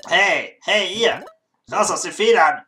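shouting the sleepers awake, pitch up; loud wake-up call. Acted. Guild apprentice shouting at sleeping newcomers, loud wake-up. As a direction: bellow them out of bed.

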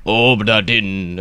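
put-on drawn-out pirate accent; playful pirate-accent flourish. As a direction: say it slowly like a mock pirate. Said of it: "have to say the pirate accent".

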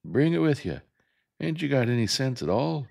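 high, loud scolding shout; angry yell. Acted. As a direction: yell at her in exasperation. Jem angrily yelling at Scout.

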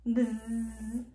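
long, steady low buzz; sustained bzzz on one note; bee buzz. Acted. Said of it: "Like a bee".

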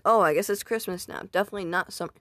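usual speaking voice

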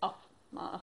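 ordinary talk

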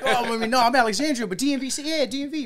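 high, loud imitation of a reveler; eager club answer. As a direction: act out a muddled, over-keen reply. Imitating his muddled club answer.

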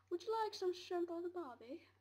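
high, loud put-on Australian voice; mock Australian accent. Offering barbecue food in a mock Australian accent. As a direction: ham up a jokey Australian accent.

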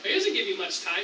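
ordinary talk

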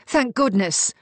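heartfelt acted outcry; relieved cry. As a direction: let relief burst out. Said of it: "Mariana cried".

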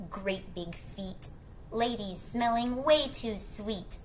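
usual speaking voice

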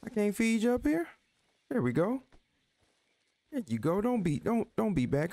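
high coaxing pet voice. Real, not acted. Coaxing horse to come down.

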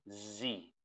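"Z" said zee, buzzed at the start; Z sound. Shown as a demonstration. Teacher repeats letter Z for students, buzzed "Z". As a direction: voice the z in "Z" clearly. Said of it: "con la Z".

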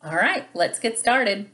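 plain reading voice

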